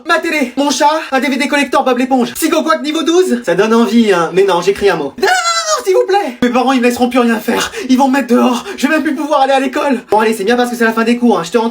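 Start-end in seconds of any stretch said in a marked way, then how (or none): 5.2-6.4 non non non lancé très haut, paniqué; supplication aiguë. Acted. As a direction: supplier la prof, la voix qui grimpe. Élève supplie de ne pas écrire. (élève supplie la prof de ne pas écrire, voix aiguë paniquée)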